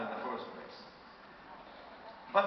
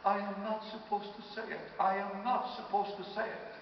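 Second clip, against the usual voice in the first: mildly tearful protest, pitched higher; higher-pitched mock weepy voice; imitated crying; 6 semitones higher than usual. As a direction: imitate a woman crying as she objects. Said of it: "crying, she says".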